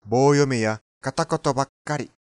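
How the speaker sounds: ordinary delivery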